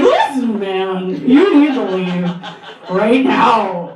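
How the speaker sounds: a hint of a little boy trying to sound tough; lightly voiced kid standing up to a grown man; eleven-year-old boy's voice. Eleven-year-old boy telling the homeless man to leave, lightly voiced tough kid. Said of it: "in his eleven-year-old voice".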